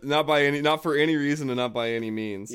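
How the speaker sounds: loud playful protest. Acted.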